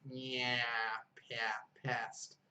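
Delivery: flat, front A drilled in "past"; flat A sound. Shown as a demonstration. Teacher confirming the vowel for student, flat A. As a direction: say "past" with a short flat A, as in "cat". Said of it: "like, flat A sound".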